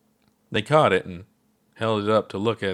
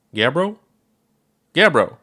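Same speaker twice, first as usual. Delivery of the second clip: rising call, then a louder shout of the name; 7 semitones higher, 7 dB louder than usual. Acted. Ponder calling out to the dozing Gabbro, loud call. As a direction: call out to wake him.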